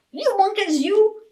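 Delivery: a peddler shaking his finger at monkeys; peddler scolding. A narrator's reading. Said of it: "He said".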